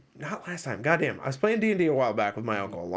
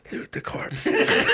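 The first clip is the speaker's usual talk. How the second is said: laughing request; much slower than usual. Retold or imitated. Retelling his loot demand again.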